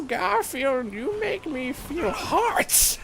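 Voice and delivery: high, slow swooning love voice; lovesick swoon. Acted. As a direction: coo it like a lovesick schoolgirl. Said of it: "with lovesick eyes".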